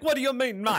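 high-pitched mock Jamaican accent; Jamaican Dracula accent. Boyfriend denying he is lost, mock Jamaican Dracula accent. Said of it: "Yeah, Jamaican Dracula".

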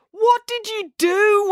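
very high, drawn-out wail; dismayed wail. A narrator's reading. April seeing her transformed room, dismayed wail. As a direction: wail it slowly, voice way up. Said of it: "wailed April".

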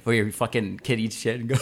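usual speaking voice

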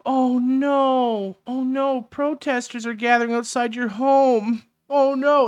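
high, loud fake dismay; mock alarm. Host jeering at report of protesters outside her home, mock alarm. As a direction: wail in pretend horror, ridiculing the worry.

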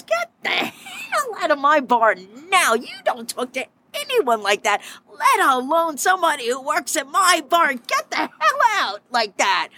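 an outraged bar owner defending her staff; furious kicking out. Retold or imitated. Owner throws customer out of bar.

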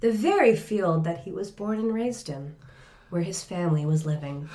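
hushed storyteller narration; story narration. A narrator's reading. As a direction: narrate softly, fading to quiet. Narrates the scene.

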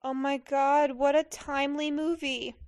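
ordinary talk, nothing marked

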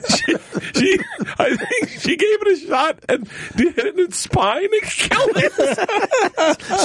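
laughing while telling it; words squeezed out through laughter. Real, not acted.

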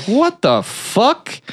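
high, drawn-out mock bewilderment; slow rising question of disbelief; bewildered. Shop owner finds food in the back room, mock bewildered.

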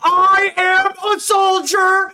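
very high, loud, drawn-out proclamation; meme impression, yelled. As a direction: wail it melodramatically, stretching every word.

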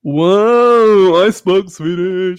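loud, delighted whoa at speaking Swedish; proud cheer after the first Swedish words; excited whoa. Real, not acted. Friend cheering himself after saying Swedish, excited. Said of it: "I'm so happy".

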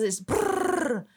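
rolled brrr of wings; bird wing flutter. Shown as a demonstration. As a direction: imitate a partridge flapping off. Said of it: "all of a sudden when it does".

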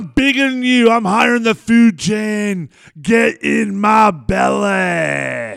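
high, bellowing Scottish glutton; Fat Bastard. Acted. Co-host redoes movie villain at full force, bellowed Scottish voice. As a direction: roar it out, dragging the last words long. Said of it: "give me fat bastard from Austin Powers".

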